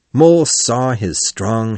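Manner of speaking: plain reading voice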